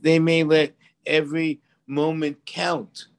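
usual speaking voice

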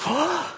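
sharp high gasp of pretend shock; mock shocked gasp. God gasping at a confession, mock shocked. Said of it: "he's not gonna be like".

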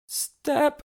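squeaky crack on the word Step. Real, not acted. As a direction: voice breaks high on Step. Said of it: "did you hear my voice crack?".

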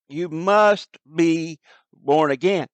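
slow and firm, must hit hard; emphatic must. Shown as a demonstration. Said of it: "The emphatic, the present tense emphatic".

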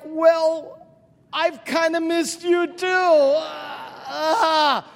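high, loud, drawn-out gushing; overwhelmed mock wail. Preacher answers the hugging little girl, hammed-up loud wailing.

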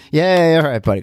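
can't help cheering him on; dad cheering his excited son; cheering along. Retold or imitated. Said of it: "you end up being like".

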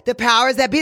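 ordinary talk, nothing marked

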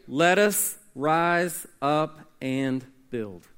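slow, low and deliberate; slow quoted reply. Acted. Preacher voicing the people's resolve, slow and low. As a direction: draw out each word of the call.

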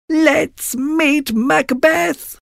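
very high, slow cackling witch. Acted. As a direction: drag it out like an old hag. Witches voiced.

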